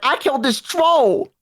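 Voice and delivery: put-on proud baby warrior. Dwarven baby bragging about its first kill, slow triumphant brag. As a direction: boast like a toddler who slew a monster. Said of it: "And it was like".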